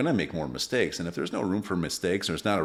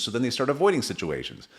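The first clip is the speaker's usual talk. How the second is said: ordinary talk, nothing marked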